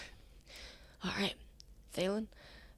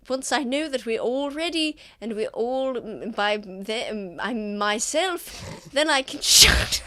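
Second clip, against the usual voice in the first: hammy stage actor; 4 semitones higher than usual. Acted. Thalen keeps stalling theatrically.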